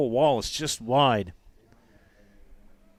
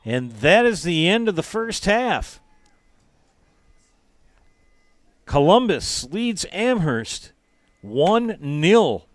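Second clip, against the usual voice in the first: excited; 6 semitones higher, 7 dB louder, slower than usual. Real, not acted. End of half call.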